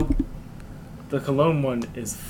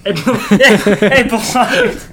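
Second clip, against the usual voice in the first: hammed-up balake through laughter, higher and louder; laughing imitation; 7 semitones higher, 12 dB louder, much slower than usual. Quoting the Key and Peele sketch to friends, laughing. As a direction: crack up while quoting the sketch. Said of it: "what did they say?".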